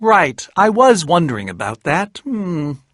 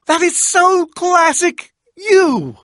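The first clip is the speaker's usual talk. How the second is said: delighted squeal; 14 semitones higher, 6 dB louder, slower than usual. Acted. Delighted reaction to a joke.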